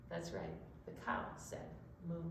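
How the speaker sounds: soft, breathy hushed whisper. Acted. Host whispers the answer to the children, hushed secret whisper. Hushed aside in kids' story.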